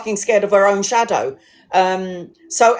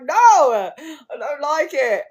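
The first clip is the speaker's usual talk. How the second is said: slow, high no through laughter; drawn-out laughing refusal; laughing protest; 6 semitones higher, much slower than usual. Real, not acted. Narrator refuses the creepy story, slow and laughing. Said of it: "I was like".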